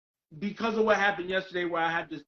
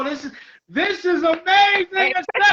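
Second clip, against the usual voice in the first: thrilled amazement; 8 semitones higher, 6 dB louder than usual. Real, not acted. Host getting a first for the show, thrilled.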